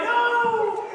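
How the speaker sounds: high loud hammed-up wail; mock cry of no. Crowd mourning the king, loud mock wail.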